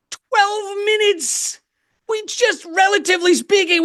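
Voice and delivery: high, stretched-out disbelief; incredulous. Real, not acted. Comic ranting about humans' short time here, incredulous. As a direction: pitch it way up in comic exasperation.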